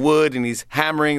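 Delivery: ordinary talk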